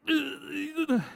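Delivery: imitating a Chinese friend who is scandalised by a kiss; disgusted ugh. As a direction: recoil from the unwanted hug with a high ugh. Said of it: "They'd be like".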